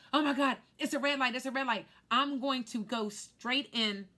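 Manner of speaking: panicked cry. Retold or imitated. Car sliding toward a red light, crying out in panic. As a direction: shout it out in rising panic.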